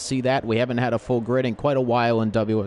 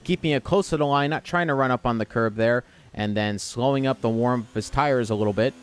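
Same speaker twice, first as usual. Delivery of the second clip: ordinary talk, nothing marked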